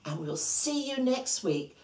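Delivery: plain reading voice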